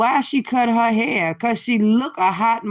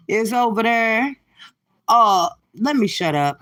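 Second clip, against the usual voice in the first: ordinary talk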